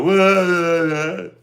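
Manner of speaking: high, loud drawn-out yawning wails; a hammed-up whining yawn; mock yawn noise. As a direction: imitate someone yawning dramatically. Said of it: "people yawn and go".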